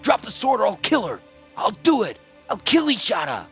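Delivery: high, loud, desperate threat; snarling shout. A narrator's reading. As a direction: snarl like a cornered beast. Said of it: "Snarling like a cornered beast, Klars shouted".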